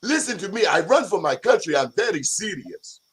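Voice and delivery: lightly put-on African accent; a hint of an African accent. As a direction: repeat it in the mock African voice. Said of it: "That's really good, [name]".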